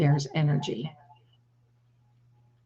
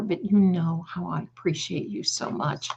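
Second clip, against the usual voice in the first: ordinary talk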